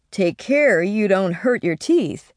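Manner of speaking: slow, fatherly caution; paternal. A narrator's reading. Winterbourne cautioning the boy, paternal and slow. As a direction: gently warn the boy like a father. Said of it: "he said paternally".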